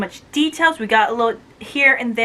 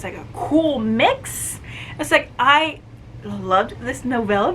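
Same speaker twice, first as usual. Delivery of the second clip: usual speaking voice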